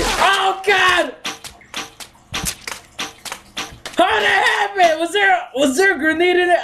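high, shouted disbelief; shocked. Real, not acted. Gamer shocked by a sudden explosion, shouting. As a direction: cry out in shock at the blast.

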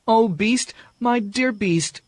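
kneeling by the dying Beast, heartbroken; anguished cry of grief; Beauty crying out. Acted. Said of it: "she cried".

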